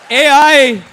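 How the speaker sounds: high, loud hype with a big whoop; rousing MC cheer. Real, not acted. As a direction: whip the crowd into applause. MC rousing applause for ChatGPT.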